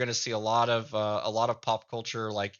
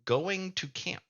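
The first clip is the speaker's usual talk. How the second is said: put-on Midwest accent, words run together, higher; thick Midwest accent; 9 semitones higher, slower than usual. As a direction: mumble it like a thick Midwest gnome parent. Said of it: "how thick their accent is".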